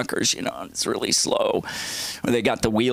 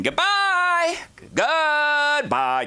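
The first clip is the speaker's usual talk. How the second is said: very high, held-out goodbye, imitating mom; mock high-pitched farewell; singsong farewell; much slower than usual. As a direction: trill a drawn-out farewell way up high. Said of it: "She said it every possible way".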